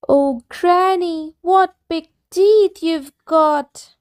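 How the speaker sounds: put-on a little girl growing uneasy; little girl alarmed. Girl wonders at the wolf.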